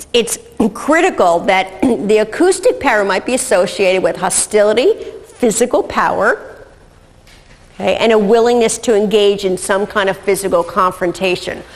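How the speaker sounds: normal talking voice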